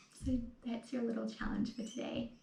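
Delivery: ordinary talk, nothing marked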